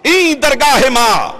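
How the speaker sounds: high, ringing proclamation; calling out. Acted. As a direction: cry it out as a divine summons. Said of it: "رحمت آواز دیتی ہے".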